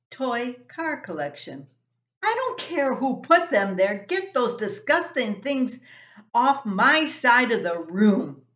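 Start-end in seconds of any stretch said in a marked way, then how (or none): 2.2-8.4 loud, higher angry outburst; teen yelling at his little brother to move the socks; angry big brother. Acted. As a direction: yell it, fed up with him. Brothers quarrel over socks.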